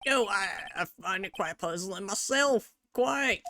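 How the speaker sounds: put-on accidental Southern accent; drawling Southern witness voice. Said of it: "God dang it, I made him Southern".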